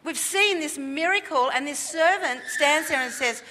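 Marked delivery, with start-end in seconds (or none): none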